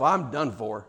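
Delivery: muttered to himself. Retold or imitated. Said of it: "he thinks to himself".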